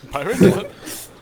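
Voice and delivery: high, loud eager question; excited. Real, not acted. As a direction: jump at the idea. Excited question about pirates.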